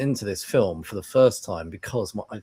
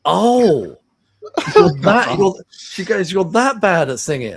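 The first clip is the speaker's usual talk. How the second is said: higher, louder mock wife; 8 semitones higher, 9 dB louder, slower than usual. Husband voicing his wife realising he sings badly, higher. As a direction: play the wife, amazed.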